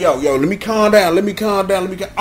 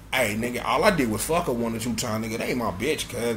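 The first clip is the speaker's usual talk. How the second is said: low voiced reply; 10 semitones lower, 13 dB quieter than usual. Other character's low reply.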